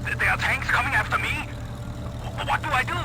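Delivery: panicked. Acted. Panicked doctor fleeing tanks.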